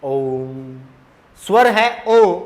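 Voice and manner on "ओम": "ओम" with the ओ held three times long; soft chant-like "ओम", long ओ; prolonged Om. Shown as a demonstration. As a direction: draw out the ओ of "ओम" slowly and softly. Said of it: "बोल के देखिए".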